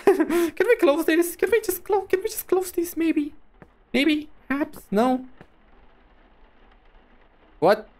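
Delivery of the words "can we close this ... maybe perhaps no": nervous pleading. Real, not acted. Scared pleading at creepy thing.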